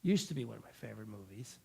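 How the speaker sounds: rattled off low and fast as a self-correction. Real, not acted. Speaker correcting their own words, low and fast. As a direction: correct yourself quickly, dropping the voice low.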